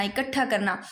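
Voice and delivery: normal talking voice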